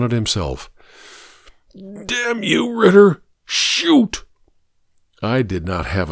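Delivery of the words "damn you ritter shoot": very high-pitched, anguished begging; cursing and pleading at the top of the range; desperate plea. Acted. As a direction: voice soaring in frantic desperation. Desperate man begs to be shot.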